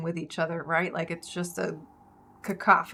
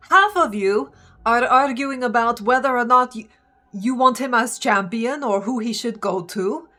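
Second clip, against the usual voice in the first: higher, emphatic character speech; impassioned plea; 4 semitones higher, 4 dB louder than usual. Acted. Seti pleading with the gods for Orin, impassioned. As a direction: press the case before a crowd. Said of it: "she's just like".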